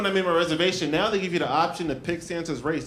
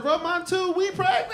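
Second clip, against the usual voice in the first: a man whining that he's pregnant too; mock pregnant whine; 13 semitones higher than usual. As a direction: squeal it like he's the pregnant one. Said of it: "I was like".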